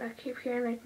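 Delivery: ordinary talk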